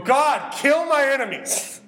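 loud, high acted cry to God; raw outburst. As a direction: blurt it out at full voice, unfiltered. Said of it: "raw, and honest".

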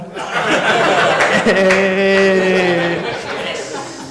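big burst of laughter; loud laugh. Real, not acted. Laughs at own joke.

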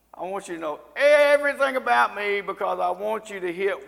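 loud, high show-off voice; crowing for everyone's attention; boastful poster. Acted. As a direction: brag at full volume, stretching the words out. Acting out boastful Facebook posters.

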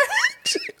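sudden high squealing laugh. Real, not acted. Reacting to friend's line.

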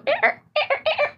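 high, slow dolphin squeaks; dolphin clicks. Shown as a demonstration. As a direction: chirp like a dolphin. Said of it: "like that. That's my dolphin".

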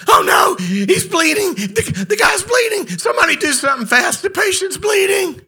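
high, loud frantic yelling, hammed-up panic; panicked shout. Imagined surgeon yelling as the patient bleeds, loud, high panicked shout. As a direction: shout it like a surgeon losing his cool. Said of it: "and he goes".

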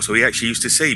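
normal talking voice

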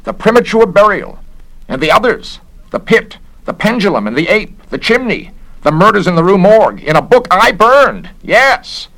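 high, loud, mounting excitement; gasping realisation. A narrator's reading. Garrett recognises the books he burned, gasping and agitated. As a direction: list them in agitated recognition, pitched high. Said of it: "gasped the investigator of moral climates".

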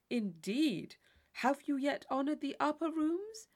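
lightly put-on eager interest; a hint of the simpering partner; mock polite inquiry. A narrator's reading. Tilney quizzing Catherine in mock formality.

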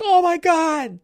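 sudden high, loud mock shriek; shocked shriek. Adventurer seeing a companion hit by the gorgon, shrieking in mock shock. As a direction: squeal it like a startled adventurer.

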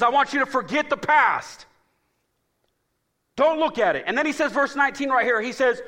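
high, loud, slowed preaching cadence; full-force preaching. Acted. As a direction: preach it out to the church.

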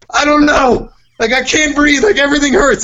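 very high, whiny mock panic; retold panic. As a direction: replay a kid's panic attack in a squeaky-high voice. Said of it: "had a panic attack".

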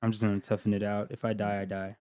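low, soft, quick aside to himself; quiet resignation. Retold or imitated. Sick passenger privately deciding to tough it out, quietly resigned. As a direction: trail down into a subdued, resigned murmur.

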